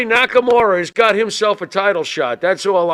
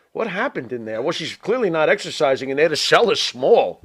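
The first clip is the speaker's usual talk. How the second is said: ordinary delivery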